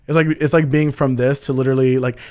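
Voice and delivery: ordinary talk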